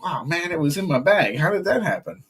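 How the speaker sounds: high, amazed how did that happen; imitating amazement. The man who lost his money, amazed and high.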